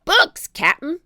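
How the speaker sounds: high, loud deckhand's shout; Pinky. A narrator's reading. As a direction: shout down a report to the captain. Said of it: "Pinky shouted down".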